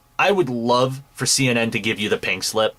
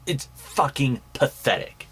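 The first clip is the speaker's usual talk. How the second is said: ordinary talk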